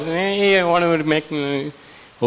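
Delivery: high-pitched mimicked crowd whine; clamouring crowd. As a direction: imitate a crowd pestering for attention.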